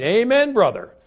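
high, loud amen called from the back; shouted amen again. Retold or imitated. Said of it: "she shouted again".

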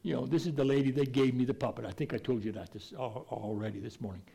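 ordinary talk

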